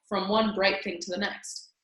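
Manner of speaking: ordinary delivery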